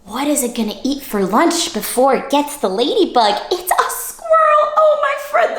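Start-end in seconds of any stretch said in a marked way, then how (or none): 3.5-5.6 high, thrilled announcement; excited reveal. Acted. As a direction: burst out with delight.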